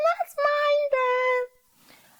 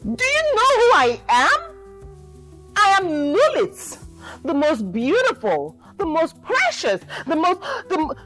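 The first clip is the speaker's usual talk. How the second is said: boastful cry; 8 semitones higher, faster than usual. Acted. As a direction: brag at full volume. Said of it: "Lulit cried".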